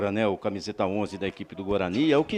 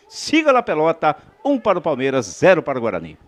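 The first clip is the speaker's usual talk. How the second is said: excited score call; 4 semitones higher, 6 dB louder than usual. Real, not acted. Score call after a joke.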